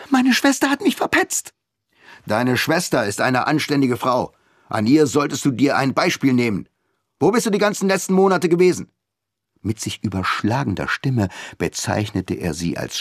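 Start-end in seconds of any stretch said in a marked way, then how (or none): 0.1-1.5 put-on high, whiny childish complaint; childish protest. A narrator's reading. Daughter sulking to her father in childish protest. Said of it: "in einen Ton kindischen Protests". 2.2-8.9 scolding father. A narrator's reading.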